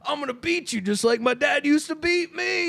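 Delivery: hammed-up threatening father, rising in pitch; mock threat. Sketch dad threatening his son, mock threat.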